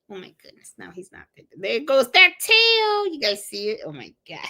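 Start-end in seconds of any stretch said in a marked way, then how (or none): 0.1-1.2 soft whispered aside; hushed, fond whisper. Real, not acted. Whispered aside about dog. 1.6-3.1 slow, loud, high and thrilled; loud, delighted cooing at the dog. Real, not acted. Delighted at wagging tail.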